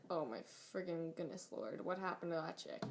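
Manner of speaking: hushed disgusted gossip. Retold or imitated. Classmates turning around to judge the new girl, lightly voiced snide gossip. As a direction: mutter it behind her back, lightly sneering.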